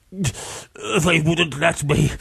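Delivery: slow, high, laboured whimper; pitiful victim. A narrator's reading. Beaten man explaining to his attacker, pitiful.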